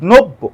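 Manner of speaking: নব্য said as "nobbo", doubled b and o sound; correct pronunciation. Shown as a demonstration. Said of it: "এটা নব্য উচ্চারণ করতে হবে".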